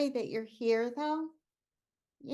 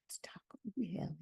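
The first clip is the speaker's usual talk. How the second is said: very soft, low whisper; hushed; 4 semitones lower, 10 dB quieter than usual. Acted. Woman who can't bear noise to her friend, whispering. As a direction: whisper it, barely voiced.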